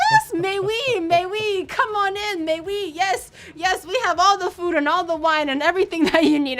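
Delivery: loud, high, gushing mock welcome; hammed-up delighted French hosts; relieved, happy welcome. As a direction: usher them in with overjoyed relief. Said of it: "the relief and happiness in their eyes".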